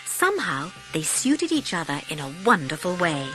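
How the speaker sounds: ordinary delivery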